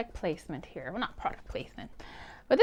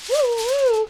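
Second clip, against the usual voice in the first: high, loud "woo" then another; high-pitched silly wooing; playful woo; 11 semitones higher, 5 dB louder than usual. Real, not acted. Playful sound as foil shines.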